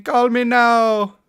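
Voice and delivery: high, slow, drawn-out psychic hotline call; slow sing-song infomercial pitch; Miss Cleo imitation. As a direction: sell it like a late-night psychic ad.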